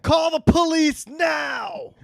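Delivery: hammed-up shrill demand; mock shouted threat. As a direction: reenact the woman yelling for the police. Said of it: "Tell the story like you told me".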